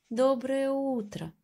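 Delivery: a light high call; mild call to the cloud. Acted. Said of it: "крикнул мышонок белому облаку".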